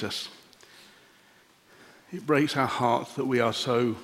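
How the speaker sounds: soft, hushed congregational prayer voice; quiet closing prayer. Acted. Pastor praying for the congregation, soft and reverent. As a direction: pray gently and reverently, fading to a whisper-soft amen.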